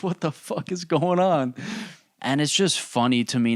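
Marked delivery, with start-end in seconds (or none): 0.0-1.5 high-pitched mock bewilderment; acted bafflement, pitched up; perplexed. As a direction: ask it in hammed-up disbelief. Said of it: "you look at that and you're perplexed".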